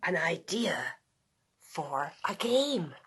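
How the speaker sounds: hushed secret whisper. A narrator's reading. Narrator whispering Dog's sneaky idea. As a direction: breathe it like a secret plan.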